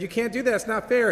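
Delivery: student objecting to his professor, high-pitched protest; upset student. Retold or imitated. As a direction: cry out that it isn't fair. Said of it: "And the student said".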